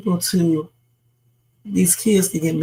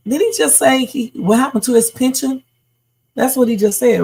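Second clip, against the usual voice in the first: higher, disbelieving question; can't believe what he just heard; a real startled double-take; surprised; 6 semitones higher than usual. Real, not acted. Reacting to the clip's pension line.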